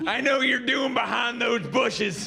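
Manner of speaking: slow, carrying coach's shout; scolding gym teacher shout; gym coach calling out. Acted. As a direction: holler across the track at misbehaving kids. Improvised gym coach calling to kids.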